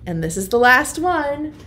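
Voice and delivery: usual speaking voice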